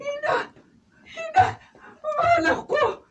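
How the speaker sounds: very high, crying out her name; joyful surprise. Acted. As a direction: call out in tearful joy. Mother sees daughter return. (gulat at tuwang pagtawag)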